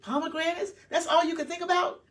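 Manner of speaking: high mocking disbelief; incredulous. Real, not acted. Preacher ridiculing the Israelites, incredulous. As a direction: scoff at their petty longing.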